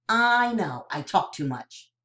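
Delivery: usual speaking voice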